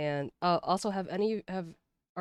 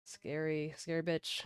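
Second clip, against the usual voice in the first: normal talking voice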